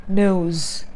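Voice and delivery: American-accented NOSE; American NOSE with a rounded O glide; American pronunciation. Shown as a demonstration. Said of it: "American pronunciation is".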